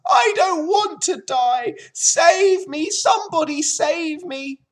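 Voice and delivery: high, loud piglet screaming for help; terrified scream. A narrator's reading. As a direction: scream for your life as the pig. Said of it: "screamed Wilbur".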